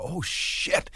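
voiceless, under the breath; quiet whispered swear; hushed oh-shit reaction. Retold or imitated. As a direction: whisper it to yourself in alarm. Startled aside as girl appears.

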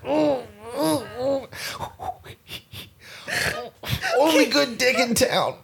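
very high groaning birth voice; giving birth. Acted. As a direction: strain through the pain of labour. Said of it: "giving birth".

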